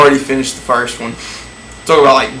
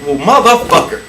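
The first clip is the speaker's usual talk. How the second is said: exasperated; 4 semitones higher than usual. Retold or imitated. Exasperated comeback to her order.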